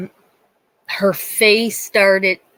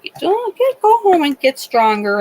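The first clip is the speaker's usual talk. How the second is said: high-pitched mimicry of a brush-off doctor; mock dismissive doctor; 8 semitones higher, 3 dB louder than usual. Retelling mother's doctor sending her home, mock dismissive. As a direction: imitate the doctor breezily, pitched way up.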